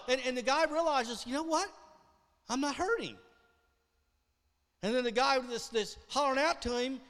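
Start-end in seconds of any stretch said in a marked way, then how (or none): 1.3-3.2 higher, amazed realization; healed leper discovering his pain is gone, surprised; dawning surprise. Acted.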